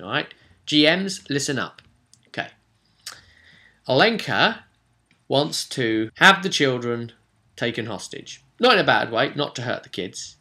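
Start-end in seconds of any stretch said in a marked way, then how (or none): none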